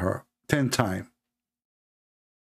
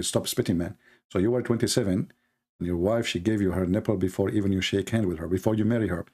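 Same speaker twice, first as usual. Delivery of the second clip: usual speaking voice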